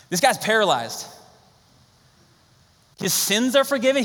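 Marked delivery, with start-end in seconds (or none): 3.0-4.0 incredulous. Shown as a demonstration. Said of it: "He wasn't asking for that".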